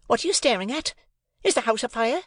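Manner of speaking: high, sharp scolding; snappish. A narrator's reading. Sister snapping at staring Joe. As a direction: needle him with a snappish question. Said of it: "in her snappish way".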